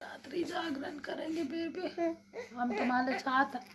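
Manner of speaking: soft night whisper. Real, not acted. Mother whispers to her baby during the sleepless night. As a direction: whisper so she can rest.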